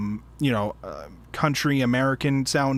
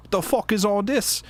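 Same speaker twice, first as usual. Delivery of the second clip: mock Cockney British accent, higher pitched; 6 semitones higher than usual. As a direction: read it as a put-on Cockney. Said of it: "a fucking Cockney British accent".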